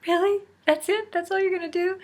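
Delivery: high disbelieving squeak of surprise; mock outraged disbelief, way up high; incredulous. To the doctor after his juice advice, high incredulous surprise. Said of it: "I was like".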